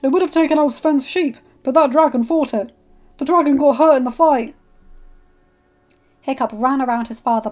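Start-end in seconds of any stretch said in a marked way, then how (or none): 0.0-4.5 loud, high, forceful report; raised voice, pressing and urgent; urgent shouting. A narrator's reading. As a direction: explain it at a shout over the commotion. Father shouts explanation amid commotion.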